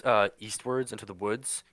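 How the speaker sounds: ordinary talk, nothing marked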